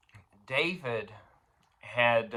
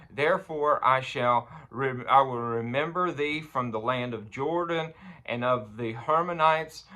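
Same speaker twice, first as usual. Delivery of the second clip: raised, ringing psalm declamation; scripture reading; 4 semitones higher, 3 dB louder than usual. Acted. Preacher reading David's cry to God, raised emphatic scripture reading. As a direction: proclaim the verse, high and emphatic.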